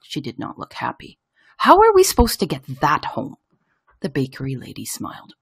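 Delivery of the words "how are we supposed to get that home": high, exasperated question; unhappy mother at a loss; dismayed mum. A narrator's reading. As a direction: ask it in pitched-up disbelief. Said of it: "cried my mum".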